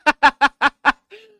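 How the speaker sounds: loud, high staccato laugh; burst of laughter. Real, not acted. Reactor to the clip's punchline, loud high laughing.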